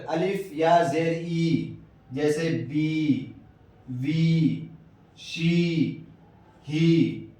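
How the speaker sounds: بی, وی, شی, ہی each with a long drawn ee; stretched ee. Shown as a demonstration. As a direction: pull the ee out long on بی and شی. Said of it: "ای سے ای بھی ہوتا ہے کھینچ کر".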